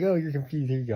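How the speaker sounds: loud, mock-helpful friend voice; loud teasing. Friend shutting the door on him, loud and teasing. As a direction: call it out with a grin.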